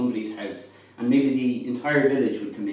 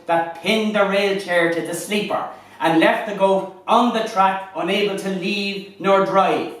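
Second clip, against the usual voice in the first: higher, lively Irish fireside storytelling; lilting irish storyteller; 6 semitones higher, 4 dB louder than usual. Acted. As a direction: spin a comic tale by the fire, building to the punchline. Shanachie performs a comic goat tale.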